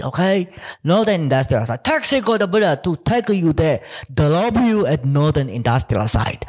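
imitating a Chinese-accented Siri; mock Chinese accent. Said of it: "Siri's Chinese response will be like".

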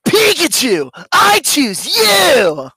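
put-on high, very slow nineties punk kid. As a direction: belt it like a nineties cartoon kid. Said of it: "in that punk voice".

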